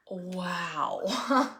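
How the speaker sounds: low, soft disbelief; stunned disbelief. Retold or imitated. Employee recalls reading the office's text, quietly stunned. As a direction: let it drop out quietly, taken aback.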